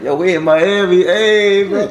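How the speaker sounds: high, loud party cheer; hyped up. Retold or imitated. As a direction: cheer like you've arrived. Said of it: "and I'm like".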